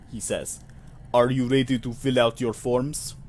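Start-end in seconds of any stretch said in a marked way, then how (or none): 1.1-3.1 put-on Russian clerk voice; faux Russian accent. Clerk explaining procedure to visitor, faux Russian accent. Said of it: "a, faux Russian accent".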